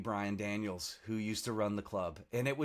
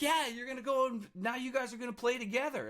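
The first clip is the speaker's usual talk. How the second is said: party planner voice pitched way up; lightly voiced planner, raised high; mock party planner; 13 semitones higher than usual. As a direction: imitate the pushy planner, much higher. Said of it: "And she's like".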